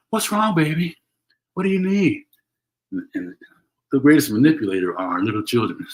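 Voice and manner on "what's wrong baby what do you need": mock coddling parent, pitched higher; fussing parent. Host mimics a parent soothing a manipulative child, lightly mocking. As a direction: imitate a parent fussing over a whining child. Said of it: "And then the parent shuffles".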